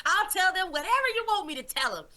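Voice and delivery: high, fast, breathless promise; frantic promising. Retold or imitated. Man rushing to promise Jesus everything, high and fast. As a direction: rush the promise out, laughing nervously.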